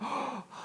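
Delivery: hammed-up high gasp of delight; a staged, starry-eyed gasp; mock gasp. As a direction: gasp in fake wonder. Mock delight at receiving policy.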